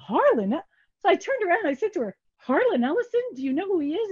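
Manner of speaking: thrilled she can't believe it; excited disbelief. Retold or imitated. Hearing her idol is coming.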